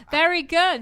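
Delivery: laughing. Real, not acted. Laughing at joke.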